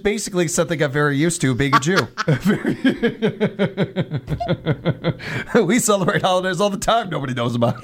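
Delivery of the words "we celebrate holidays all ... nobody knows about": higher, through real laughter; laughing. Real, not acted. Host joking about their own holidays, laughing. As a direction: talk while laughing at your own joke.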